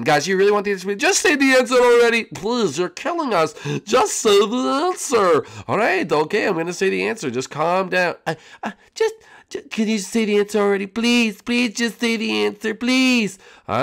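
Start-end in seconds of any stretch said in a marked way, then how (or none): 0.0-5.4 pleading puppet voice. Acted. Contestants begging host for answer. 8.3-13.4 pleading puppet voice. Acted. Contestant begging for the answer.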